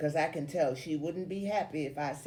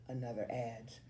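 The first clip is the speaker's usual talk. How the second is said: usual speaking voice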